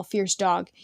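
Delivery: ordinary delivery, nothing marked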